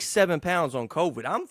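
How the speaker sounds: usual speaking voice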